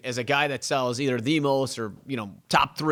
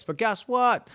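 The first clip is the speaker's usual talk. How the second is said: ordinary talk, nothing marked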